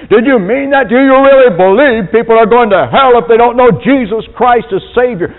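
high, loud accusing reporter; indignant newsman challenge; hostile interviewer. Retold or imitated. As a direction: grill him on live TV. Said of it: "confronting them and saying".

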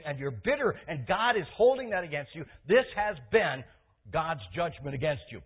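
loud, forceful retold rebuke from the pulpit; a hard, unmerciful loud rebuke; stern rebuke. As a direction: thunder it at him like a prophet. Pastor retells rebuking the man.

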